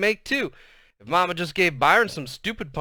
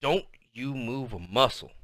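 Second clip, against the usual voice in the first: quiet, super-mad Southern mama; low-key Southern-accented threat; 3 semitones lower, 5 dB quieter than usual. A narrator's reading. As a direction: warn him softly in a Southern accent. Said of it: "talking in that real Southern style accent".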